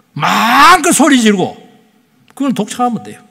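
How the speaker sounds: high, loud, exasperated preaching cry; impassioned preaching. Acted. Pastor crying out to the congregation, high and loud. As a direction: belt out the frustration of holding back.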